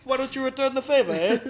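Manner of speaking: weird Welsh-sounding Canadian attempt; fast, high, loud attempt at Canadian, slipping into Welsh; botched Canadian accent. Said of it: "It was, like, weird, like, Welsh?".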